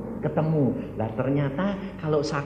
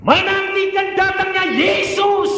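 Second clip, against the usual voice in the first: high, full-force pulpit cry; preacher's shout; 5 dB louder than usual. Real, not acted. Preacher proclaims waiting for Jesus, shouted. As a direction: shout it out high to the whole congregation.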